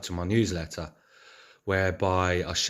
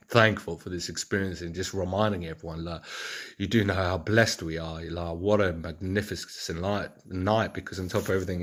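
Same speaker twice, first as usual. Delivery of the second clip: normal talking voice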